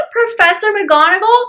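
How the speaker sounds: squealing high with glee; excited squeal. Real, not acted. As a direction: gush her name like a fan. Fangirling over McGonagall.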